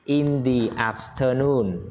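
"the" said as "thee", slow, before "afternoon"; teacher models "thee afternoon" slowly; slow model reading. Shown as a demonstration. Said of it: "đọc là the".

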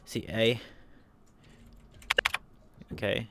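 ordinary talk, nothing marked

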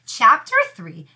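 ordinary delivery, nothing marked

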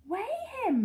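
high, loud command; urgent order. A narrator's reading. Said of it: "Mister Hoppy ordered".

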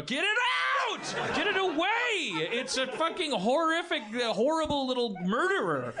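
put-on a kid screaming in horror to get the doll away; terrified child's shriek. As a child shrieking at mom's marionette to take it away, terrified high yell.

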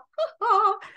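high gleeful cackle; little man's triumphant giggle; gloating mock laugh. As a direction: cackle high, pleased with yourself. Little man gloats over wrong guesses.

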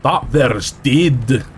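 put-on slow, drawn-out Scottish accent. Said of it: "I'm trying to do Scottish".

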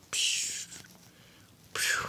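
soft voiceless "psh" effects; quiet mouth-made blast noise; imitated whoosh. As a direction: puff out play-fight sound effects. Imitated noise in play fight.

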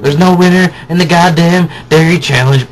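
emphatic exclaim. Real, not acted. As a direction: declare it loudly. Repeated, higher and louder.

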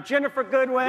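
loud, high announcing call; announcer shout. Real, not acted. Host calling out the guest's name to the crowd, shouting.

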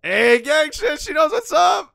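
loud, high excited hollering; hyped shout. Real, not acted. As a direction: cheer her on at full volume. Hyping the girl's catchphrase.

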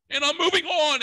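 flustered high brush-off; high squeaky dodge. Retold or imitated. Nervous comic hurrying past the sailor bit, high and squeaky.

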